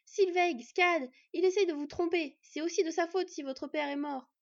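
loud, high urgent warning; crying out. A narrator's reading. Borde warning the two sisters of deception, crying out. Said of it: "Il s'écria".

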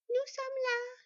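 put-on very high little-girl voice; little girls exclaiming. A narrator's reading. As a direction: pipe up like children announcing themselves. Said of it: "s'exclamèrent Sylveg et Scad". (voix de petites filles, très aiguë)

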